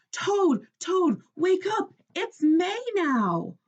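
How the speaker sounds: high, loud, slow excited calling; excited wake-up call. A narrator's reading. Frog rousing sleepy Toad in bed, excited high call. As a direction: shout him awake with joy. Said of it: "Frog ran back to Toad's bed".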